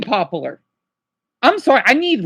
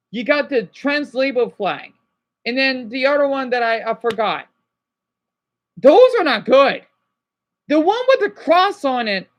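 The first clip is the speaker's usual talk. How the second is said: ordinary talk, nothing marked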